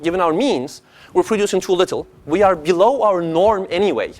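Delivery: ordinary talk, nothing marked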